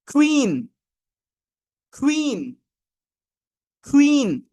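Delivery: "কুইন" with a breathy kh onset, high then falling; aspirated K. Shown as a demonstration. Teacher models queen for the class, aspirated K. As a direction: drill "কুইন" with K like খ. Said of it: "খ এর মতো করে একটু সাউন্ডটা আসবে".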